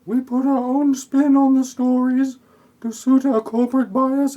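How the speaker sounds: high, loud cartoon-villain voice; mock villain voice. Recapping the movie for listeners, voicing the greedy media boss in a high, loud mock voice.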